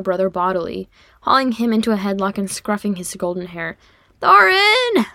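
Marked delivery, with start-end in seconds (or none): 4.2-5.1 loud, high wail of protest. A narrator's reading. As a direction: cry the name out high and loud. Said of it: "he wailed".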